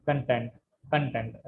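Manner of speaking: Content with weak కన్ and strong tent; content: soften కన్, push tent; stress on second syllable. Shown as a demonstration. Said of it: "కన్ ... week అయిపోతుంది".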